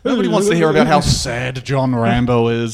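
loud scoffing gripe about Rambo; a hammed-up buddy grumbling; mock complaint. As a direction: complain loudly, fed up. Acted platoon friends complaining.

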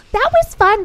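high, prim mom voice. Retold or imitated. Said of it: "she's like".

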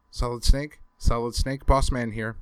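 radio call. Acted.

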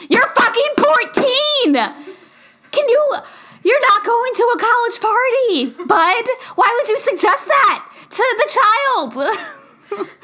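high, incredulous scolding; exasperated outburst. Acted. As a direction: raise your pitch, appalled and disbelieving. Exasperated outburst at film's advice.

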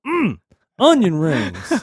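slow, high, drawn-out quote; character voice. Retold or imitated. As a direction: stretch it out in a put-on voice. Retelling movie scene, quoting character.

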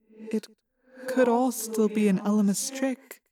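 soft, unconvinced suggestion; half-hearted. A narrator's reading. Axe doubting to Rachel that it is over, half-hearted. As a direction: offer it weakly, without conviction. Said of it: "Axe said half-heartedly".

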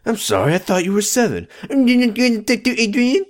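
high mocking voice; mock whine. Teasing Leo and Ben, high mocking whine. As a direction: whine it like a brat.

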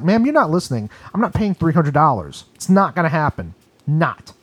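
ordinary talk

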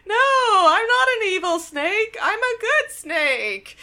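high, drawn-out whine; mock whiny protest. Wong denying he's an evil snake, mock whiny.

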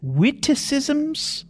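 high, slow disbelief; incredulous. Real, not acted. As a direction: echo it, baffled. Baffled echo of strange word.

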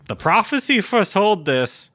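high, loud prophetic elder voice; epic fantasy narrator. Retold or imitated. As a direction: intone it like an ancient prophet. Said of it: "raccoon that's kinda like".

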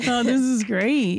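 ordinary talk, nothing marked

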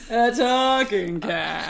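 laughing, very drawn-out "talking"; misplaced emphasis. Retold or imitated. Friend echoing the odd cat line, "talking" drawn out. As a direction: stretch "talking" slowly through a laugh. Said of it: "the emphasis is on the wrong syllable".

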